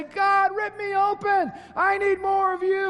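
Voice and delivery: high, loud pleading to God; crying out prayer. Pretend. Congregation crying out to God, a high loud plea. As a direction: cry it out like a desperate prayer. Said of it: "This is our time to say".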